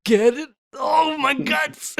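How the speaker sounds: slow, high cry of pain; pained shout. Acted. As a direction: howl as if gut-struck. Character hit, crying out.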